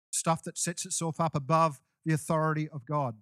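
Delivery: ordinary talk, nothing marked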